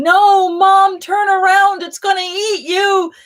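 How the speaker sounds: put-on panicked child's scream, very high and loud; frantic kid yelling at mom to run from the dinosaur; shrieking child. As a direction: shriek like a terrified little boy warning his mom. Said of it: "is shrieking".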